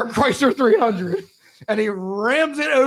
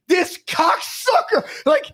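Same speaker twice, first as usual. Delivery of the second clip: dad in traffic; 10 semitones higher than usual. Retold or imitated. Retold fuming driver.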